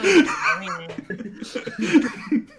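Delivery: laughing through words; words while still laughing. Real, not acted. Laughing at GM's joke.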